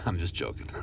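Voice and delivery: laughing it off softly. Real, not acted. After joking about bosses, laughing softly. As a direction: chuckle through the disclaimer.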